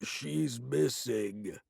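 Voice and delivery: slow, stuffed-nose voice after the fall; clogged voice. A narrator's reading. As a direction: drag out Millie through a swollen nose. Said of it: "he said in a clogged voice".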